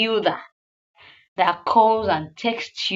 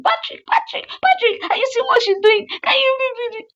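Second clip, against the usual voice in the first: shrill panicked shout, far higher; 4 dB louder than usual. Retold or imitated. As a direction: scream for the boss to help, near crying. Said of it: "the P A was now shouting".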